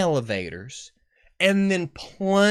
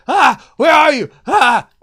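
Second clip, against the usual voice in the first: frantic high yell, much louder; panicked screaming; 12 semitones higher, 14 dB louder than usual. Pretend. Owner searching for missing pets, frantic high scream. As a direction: scream for a lost pet in panic. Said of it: "I would just be screaming".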